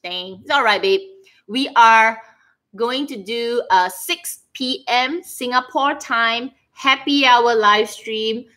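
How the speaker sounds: ordinary delivery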